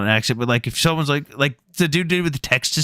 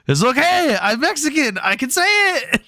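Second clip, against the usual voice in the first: much higher, slow, casual mock voice; mock Mexican guy; 12 semitones higher than usual. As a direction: play the carefree guy, pitched way up. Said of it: "He goes".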